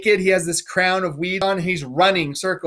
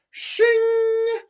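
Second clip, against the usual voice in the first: very high ringing shing of a blade; sword shing sound. Shown as a demonstration. Oswald drawing his sword on Gloucester, a ringing shing. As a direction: mimic a sword leaving its sheath. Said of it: "So he draws his sword".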